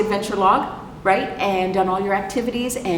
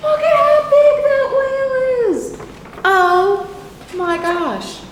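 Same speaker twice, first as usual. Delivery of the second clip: amazed; 7 dB louder, slower than usual. Real, not acted. Amazed at huge whale picture.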